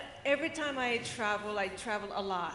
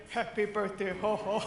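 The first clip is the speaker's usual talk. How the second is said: put-on grumpy guard voice, louder on the ho-ho; grumpy birthday wish; 3 semitones lower, 4 dB louder than usual. Retelling the security guy's wish, mock grumpy. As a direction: imitate a grudging guard's birthday greeting. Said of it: "The grumpiest birthday wish ever".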